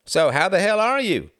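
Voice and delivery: booming greeting. A narrator's reading. Frederick greets his old friend Reginald in Oxford, booming. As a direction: boom it out heartily. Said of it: "he boomed".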